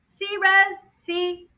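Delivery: high little drone voice proving a point; piping, insistent drone; I-told-you-so drone. Acted. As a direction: pitch it way up, pointed. Fly proves its point.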